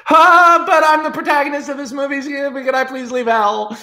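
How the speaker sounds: high, fast, whiny plea; pleading Clive. Acted. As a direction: beg your way out, squeaky and rushed. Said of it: "Clive is like".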